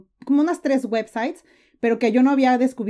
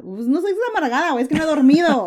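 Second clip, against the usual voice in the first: indignant retort; 6 semitones higher, 5 dB louder than usual. Retold or imitated. Retorting to the critics.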